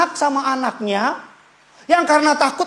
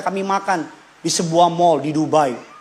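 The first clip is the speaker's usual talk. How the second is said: normal talking voice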